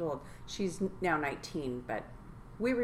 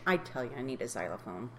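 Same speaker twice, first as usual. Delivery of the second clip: ordinary talk, nothing marked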